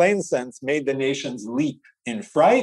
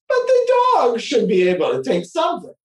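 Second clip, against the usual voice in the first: raised insistent voice; 7 semitones higher than usual. Retold or imitated. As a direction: insist the dog can carry some. Reasoning as the farmer.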